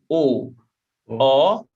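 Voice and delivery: closed O, rounded; closed vowel. Shown as a demonstration. Teacher contrasting the two sounds, closed O. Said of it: "So we change the sonority".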